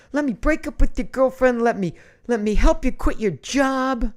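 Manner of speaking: loud, high, insistent sales pitch; mock-exasperated plea. Host begs viewers for breakup jobs, mock exasperated. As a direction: plead the offer with comic frustration.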